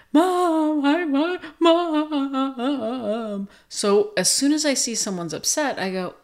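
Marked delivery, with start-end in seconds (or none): 0.1-3.5 high, loud mock wail drawn out long; child crying. Two-year-old left with grandma as parents leave, mock crying. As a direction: wail for Mom like a toddler, hammed up. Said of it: "They start crying".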